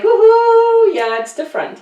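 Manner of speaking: high, loud woohoo of a carefree tourist; mock tourist cheer. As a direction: play the thrilled vacationer. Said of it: "it's like".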